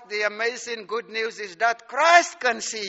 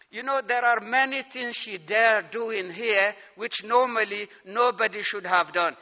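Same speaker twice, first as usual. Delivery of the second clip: normal talking voice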